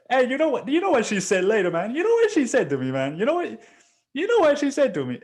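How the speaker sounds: very high, excited mock gossip; high-pitched, loud mocking chatter; oblivious phone chatterer. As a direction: imitate an oblivious caller, repeating yourself excitedly.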